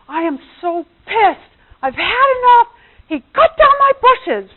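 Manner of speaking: high, loud, outraged complaint; friend's angry rant. Retold or imitated. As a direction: vent like a friend whose bushes got chopped. Friend furious about cut bushes.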